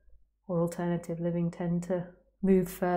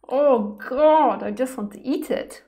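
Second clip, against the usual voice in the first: overwhelmed by cuteness; 5 semitones higher, 8 dB louder, slower than usual. Real, not acted. Cuteness outburst.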